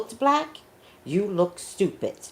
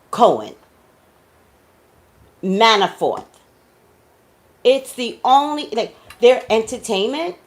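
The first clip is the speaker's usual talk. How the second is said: loud, higher, heated tirade; angry rant; 6 semitones higher, 6 dB louder than usual. Real, not acted. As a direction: call TMZ out, getting louder. Rant against TMZ.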